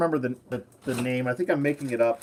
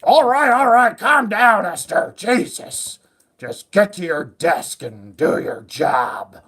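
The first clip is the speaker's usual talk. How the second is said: higher, louder, exasperated boss; a put-upon boss telling her to calm down; 5 semitones higher, 7 dB louder, slower than usual. Pretend. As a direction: boss waving off the frantic mother. Boss answers the shrieking mother.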